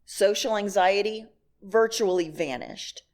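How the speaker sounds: normal talking voice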